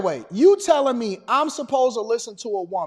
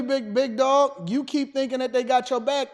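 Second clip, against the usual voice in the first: ordinary talk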